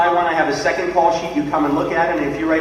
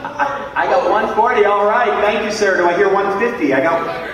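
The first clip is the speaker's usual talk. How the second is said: higher, lively auction patter; auctioneer calling bids; 7 semitones higher than usual. Acted. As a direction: run the room like a charity auctioneer.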